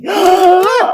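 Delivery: sudden high scream of fright; startled scream. Real, not acted. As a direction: shriek at a surprise. Sudden scare in garden.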